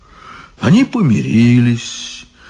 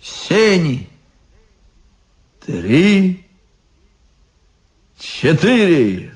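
mournful announcement; 7 semitones higher, much slower than usual. Acted. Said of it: "печально возгласил".

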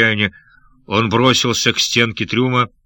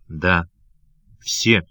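low, halting, voice catching; broken voice; 4 semitones lower than usual. A narrator's reading. Wounded pirate confirming all are dead, halting lowered voice. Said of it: "ответил даяк прерывающимся голосом".

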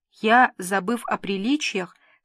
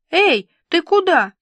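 higher, louder anxious call after someone; worried call; 8 semitones higher, 7 dB louder than usual. Acted. Friend calling after Vilka walking out, worried call. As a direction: call her back in sudden worry. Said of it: "забеспокоилась подруга".

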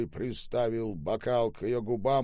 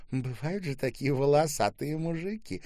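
raised-pitch amused remark; amused sneer; 7 semitones higher, 3 dB louder than usual. A narrator's reading. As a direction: remark with a little scoff. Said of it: "усмехнулась Ольга". (с усмешкой)

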